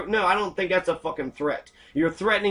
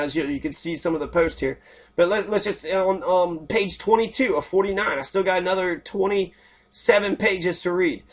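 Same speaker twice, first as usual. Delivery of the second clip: ordinary talk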